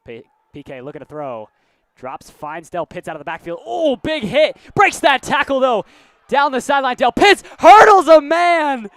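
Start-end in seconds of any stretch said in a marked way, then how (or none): 3.6-8.9 big, high excited call as he breaks free; excited play call. Real, not acted. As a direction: yell it out like a live play-by-play caller.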